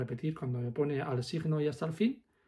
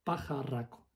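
slow rhythmic counting; 2 semitones higher, 3 dB louder, much slower than usual. Shown as a demonstration. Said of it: "en vez de decir uno".

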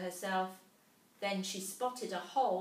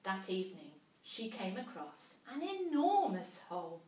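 plain reading voice

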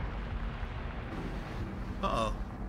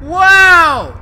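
loud, high "wow", falling away; big startled exclamation; amazed shout; 7 semitones higher, 14 dB louder than usual. Real, not acted. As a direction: cry out in astonishment. Startled by game event.

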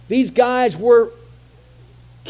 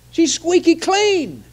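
mock frustration; 9 semitones higher, 4 dB louder, slower than usual. Daniel's enemies scheming against him.

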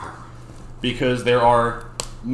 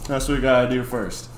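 normal talking voice

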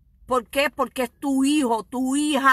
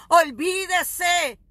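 preaching shout; 5 semitones higher, much slower than usual. Acted. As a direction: sweep every excuse aside. Preacher exhorting fasting.

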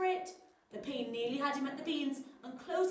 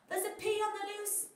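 higher, urgent hero voice; hero's warning; 8 semitones higher than usual. Acted. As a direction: hero alerting everyone. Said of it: "said Sweet Potato".

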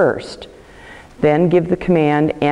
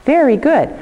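high praise; 10 semitones higher than usual. Real, not acted. Praising the dog.

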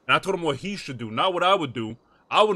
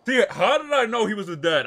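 laughing through the words; 5 semitones higher, 5 dB louder than usual. Real, not acted. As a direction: chuckle while you ask it. Laughing at dad guess.

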